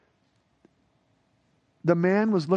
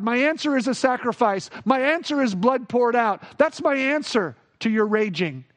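full-force preaching; 6 semitones higher than usual. Acted. Preacher voicing God to the raging world, proclaiming. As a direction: hammer each my answer home.

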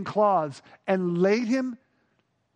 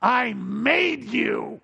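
gentle rebuke; much slower than usual. Retold or imitated. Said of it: "I can see Jesus looking up saying".

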